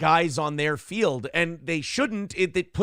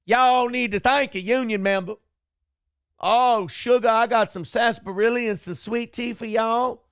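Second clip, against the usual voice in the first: fake Southern accent; 7 semitones higher, 3 dB louder than usual. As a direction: ham up a sugary Southern accent. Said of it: "She's got that Southern accent".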